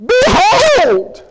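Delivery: high, loud shouting mother, slowing down; a mother scolding her son at full pitch; exasperated mother. Retold or imitated. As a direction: cries "Behold!" high and loud. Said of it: "She says".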